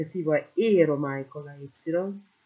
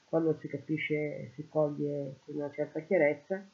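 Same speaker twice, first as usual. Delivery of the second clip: normal talking voice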